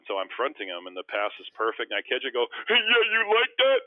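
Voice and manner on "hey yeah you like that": high, loud re-enacted on-court taunt; mock gloating shout; taunting yell. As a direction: crow it at a rival, voice way up.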